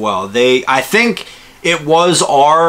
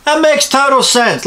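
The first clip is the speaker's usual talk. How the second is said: sarcastic; 9 semitones higher than usual. Real, not acted. Sarcastic mock agreement.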